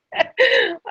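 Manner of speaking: a real high, choked laugh close to tears; tearful laugh. Real, not acted. Moved to tears recalling the boy's hope, laughing tearfully. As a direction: laugh through welling tears.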